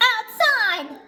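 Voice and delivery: high, urgent cartoon exclamation; alarmed. SpongeBob tells Patrick where the monster is, alarmed. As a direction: blurt it out in a high voice.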